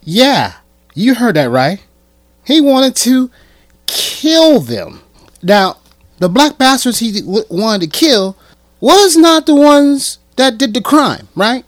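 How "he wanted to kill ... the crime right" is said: rising outrage, higher and louder; outraged. Real, not acted. Vlogger to viewers, outraged and loud. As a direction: hammer home the shock to viewers.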